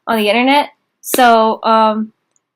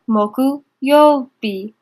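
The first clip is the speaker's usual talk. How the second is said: very slow syllable-by-syllable; much slower than usual. Shown as a demonstration. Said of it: "teacher stretches reading slowly".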